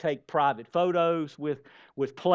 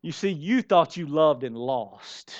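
usual speaking voice

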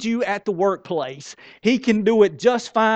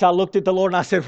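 ordinary talk, nothing marked

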